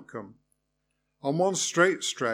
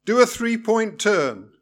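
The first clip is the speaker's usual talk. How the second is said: higher and louder, curt order; snapped; 7 semitones higher, 7 dB louder than usual. A narrator's reading. Irritated examiner ordering the turn. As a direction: bark the order short. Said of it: "he snapped".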